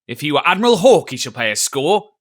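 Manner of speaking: loud indignant landlord's outburst; indignant cry. A narrator's reading. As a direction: bark it out, offended. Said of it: "cried Silver".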